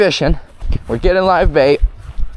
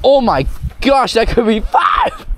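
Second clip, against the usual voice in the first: excited shout; 7 semitones higher than usual. Real, not acted. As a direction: shout it in disbelief. Sizing up a big catch.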